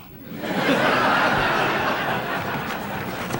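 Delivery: high unvoiced laugh at his own punchline; real laughter. Real, not acted. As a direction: break up laughing at the joke. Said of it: "teller laughs at own joke".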